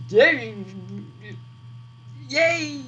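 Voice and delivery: high-pitched happy yay; excited cheer. Real, not acted. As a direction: cheer it out, thrilled. Cheering own good news.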